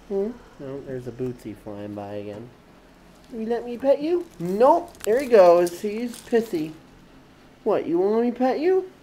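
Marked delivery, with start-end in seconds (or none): 3.3-8.9 high pet-talk voice. Real, not acted. Owner coaxing her cat to be petted, high pet talk.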